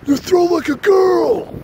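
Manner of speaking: drawn-out high holler from the outfield; high, loud, slow heckle; imitating buddy's yell. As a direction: yell a taunt across the field, stretched out. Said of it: "He decides to yell".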